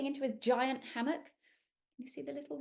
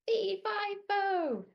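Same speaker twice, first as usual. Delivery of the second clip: giant's shout; 10 semitones higher, 4 dB louder, much slower than usual. Acted. Giant voice repeated.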